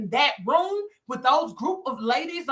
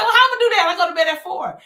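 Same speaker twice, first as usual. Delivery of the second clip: incredulous protest; 5 semitones higher, 5 dB louder, faster than usual. Retold or imitated. Arguing back at the Lord.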